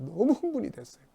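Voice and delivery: normal talking voice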